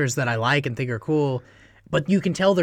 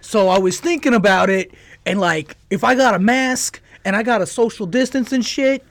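loud, higher bro comic; hack comedian voice; 6 semitones higher, 5 dB louder than usual. Retold or imitated. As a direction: ham it up like a stand-up. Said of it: "six guys in a row go".

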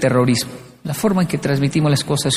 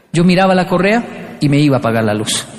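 ordinary talk, nothing marked

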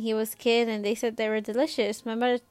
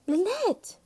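higher, startled mother calling her name; shocked mom; 9 semitones higher than usual. A narrator's reading. Mom shocked at daughter's rudeness, startled call.

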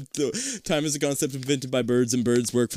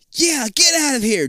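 put-on bratty kid brother; 12 semitones higher, 5 dB louder than usual. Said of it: "[name] adds".

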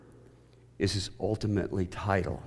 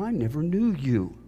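a touch of a scornful punk; preacher's first casual take of Jesus; 7 semitones higher than usual. Acted. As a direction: flip it off dismissively. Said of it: "Sorry for the inflection of my voice".

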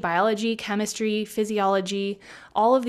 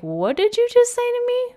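much higher and louder, in a changed voice; mock shock; 5 dB louder than usual. Stylist retelling her reaction to the client, mock stunned. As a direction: throw it back in mock disbelief.

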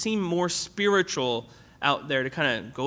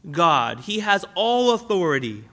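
ordinary delivery

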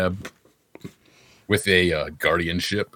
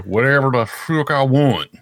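attempted Pennsylvania accent, higher; weird Pennsylvania accent; 6 semitones higher, 5 dB louder than usual. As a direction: have a rough go at a Pennsylvania accent. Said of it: "the Pennsylvania weird accent".

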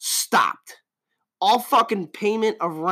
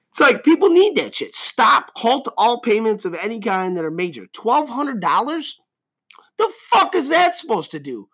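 pissed off; 4 semitones higher than usual. Real, not acted. Ranting host boils over at the listeners, furious shout. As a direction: let the anger boil over into a shout. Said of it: "I'm getting pissed".

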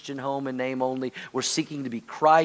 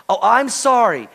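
higher, louder mock apology; excuse-laden apology; 6 semitones higher, 5 dB louder than usual. Shown as a demonstration. Preacher shows how not to apologize, insincere mock apology. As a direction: apologize insistently, as if an excuse is coming. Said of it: "Don't say".

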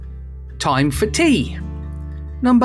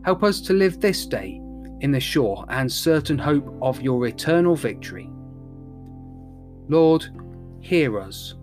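prayer; 4 semitones lower, 7 dB quieter than usual. Acted. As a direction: lead the prayers gently. Leads intercessions in prayer.

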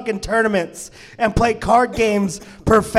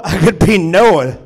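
ordinary talk

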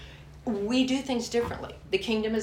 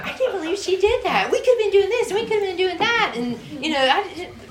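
high, loud, exasperated ranting; mock indignant rant; 12 semitones higher, 7 dB louder than usual. Wife stomping around fuming to her husband, high and loud. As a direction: replay your own fuming at home, hammed-up. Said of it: "and I was mad".

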